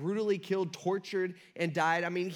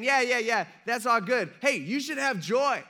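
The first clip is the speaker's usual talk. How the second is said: higher, louder, blithe cheer; breezy mock advice; 5 semitones higher, 6 dB louder than usual. Preacher voices Paul advising strugglers, breezy mock advice. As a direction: brush it off with loud, chirpy confidence.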